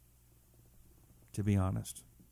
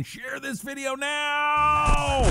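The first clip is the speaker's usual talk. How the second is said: hype shout; 12 semitones higher, much slower than usual. Acted. Host promo shout.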